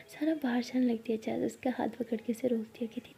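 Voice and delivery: hushed, breathy narration; whisper. A narrator's reading. Narrator whispering the lovers' scene.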